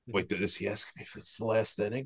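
hushed, puzzled aside to himself; quietly working it out in his head; puzzled inner thought. Retold or imitated. Retelling his confusion at the men's league game, hushed and puzzled.

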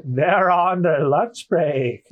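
very slow goat bleat; exaggerated, dragged-out goat man. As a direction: stretch every word like a goat. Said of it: "I'm gonna read him as a goat".

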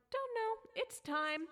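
high-pitched tearful squeak; mock crying. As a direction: answer as if through tears, hammed up. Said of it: "Why are you crying?".

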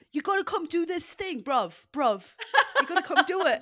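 high, loud put-on accent; Joel Petit. Co-host mimicking a friend urging CrossFit, hammed-up accent. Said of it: "He's gonna kill me for that accent".

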